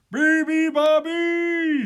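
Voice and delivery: loud, high-pitched bellow; booming drawn-out animal call; thundered. A narrator's reading. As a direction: thunder it out with full force. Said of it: "thundered the moose and the water buffalo".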